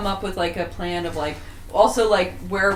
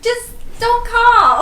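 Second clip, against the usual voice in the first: high, drawn-out loud wail; hammed-up nagging wife, pitched way up; fed-up wife; 6 dB louder, much slower than usual. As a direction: play an exasperated wife on the phone. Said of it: "play like your wife that just was like".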